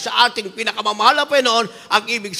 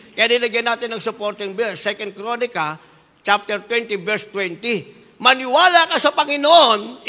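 normal talking voice

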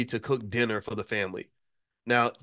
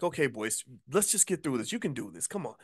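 much softer, muttered encouragement to himself; quiet self pep talk; 6 dB quieter than usual. Retold or imitated. Cooking chicken, quietly coaxing himself on. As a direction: coach yourself under your breath. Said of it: "I'm like".

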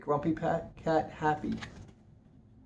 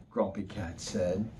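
usual speaking voice

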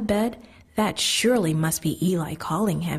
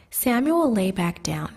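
usual speaking voice

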